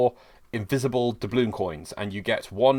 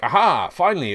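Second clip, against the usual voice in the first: excited; 6 semitones higher, 6 dB louder than usual. Retold or imitated. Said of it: "you'll notice I'm like".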